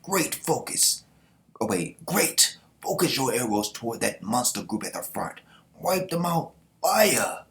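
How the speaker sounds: high barked orders building to a loud fire command; commander's orders. Acted. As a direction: order the archers, ending on a shouted fire. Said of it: "They like".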